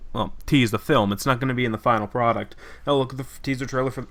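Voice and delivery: ordinary talk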